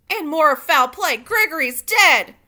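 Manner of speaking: loud, high alarmed shout, slowing on dead; loud shout with a slow, drawn-out dead. Acted. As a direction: cry the news out across the kitchen. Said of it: "shouted Cook".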